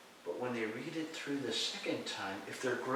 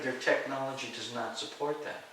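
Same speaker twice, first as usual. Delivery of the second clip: usual speaking voice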